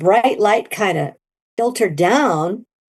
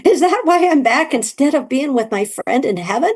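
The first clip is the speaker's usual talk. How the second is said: high, playfully exasperated; mock indignation; 8 semitones higher than usual. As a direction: huff in mock outrage. Mock outrage at mother.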